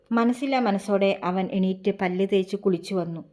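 plain reading voice

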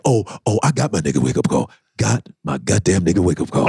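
low, fast mock panic; a dropped, rushed retelling of his fright; mock shaken man. Acting out man shaken by scare.

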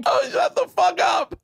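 high shouted disbelief; incredulous shout. Real, not acted. Listener hearing the leg twist, incredulous shout. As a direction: yell it in delighted shock, sky-high.

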